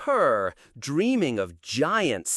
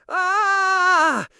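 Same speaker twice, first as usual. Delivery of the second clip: explosive high sneeze wind-up; huge sneeze; 14 semitones higher, 5 dB louder than usual. Acted. Cat sneezing into the room, big explosive sneeze. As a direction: let the giant sneeze burst out.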